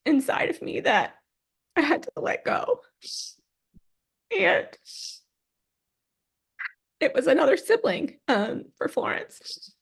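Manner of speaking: choked up, voice rising high; halting through tears, long pauses; tearful grief. Real, not acted. Grieving the lost baby, tearful again.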